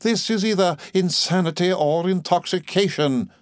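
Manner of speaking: high, prim outraged voice; agonized Miss Murdstone. A narrator's reading. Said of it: "in a perfect agony".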